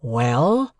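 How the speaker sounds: Well? pressed with weight and a rising end; emphatic well. A narrator's reading. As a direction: lean on Well? pointedly. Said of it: "repeating it with emphasis".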